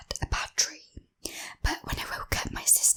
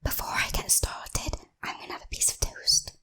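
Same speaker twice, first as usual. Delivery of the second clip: ASMR whisper. Real, not acted. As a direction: whisper it close to the mic.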